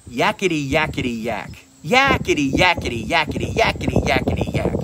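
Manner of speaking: loud demon chatter. Retold or imitated. Demon jumps between preacher and Jesus, loud mock yakety chant. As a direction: rattle it off like a jeering little imp. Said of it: "he began to say real loud".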